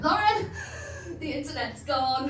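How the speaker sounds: a hint of a confused old nan; mock nan. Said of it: "they would come out with".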